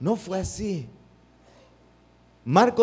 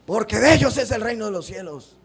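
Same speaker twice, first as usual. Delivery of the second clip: loud, higher indignant proclamation; indignant Jesus; 6 semitones higher, 7 dB louder than usual. Shown as a demonstration. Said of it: "Así me lo imagino". (Jesús indignado, más alto y fuerte)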